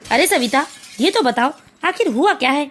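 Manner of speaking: a husband's low voice, puzzled by the shouting; father's voice. Acted.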